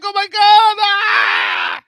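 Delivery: shrieking, high and loud, in a hammed-up panic; mock panic. Host mocking a drafter on the clock, hammed-up panicked shriek. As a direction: play the drafter losing it on the clock, screaming. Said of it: "and you're like".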